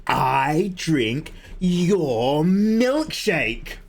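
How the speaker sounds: very slow, drawn-out gloating taunt; gas man. Acted. Gas man spoiling the householder's tea, hammed-up villain. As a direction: stretch each word out, rising high, as a hammy villain. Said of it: "And then he's like".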